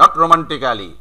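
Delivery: romantically with a full "-ka-li" ending; spell-it-out "ro-man-ti-KA-li"; Bangladeshi mispronunciation. Shown as a demonstration. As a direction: sound every syllable of romantically, Bangla style. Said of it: "বাংলাদেশীয় উচ্চারণ".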